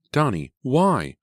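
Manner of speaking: loud, high, drawn-out reproach; high-pitched, distressed questioning; upset confrontation. A narrator's reading. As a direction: demand an explanation, stretching the words. Jenny confronts frightened little brother.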